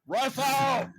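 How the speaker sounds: high, drawn-out name call. Acted. Calling out the guest's name.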